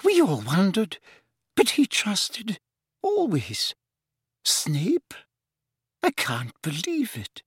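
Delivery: high, halting professor in shock; weak, bewildered, with long pauses; stunned McGonagall. Acted. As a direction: falter in disbelief, high and fading. Narrator voices stunned McGonagall.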